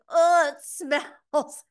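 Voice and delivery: high, loud, very slow disgusted whine; mock groan of disgust, stretched out; Kevin imitated. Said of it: "Kevin's like".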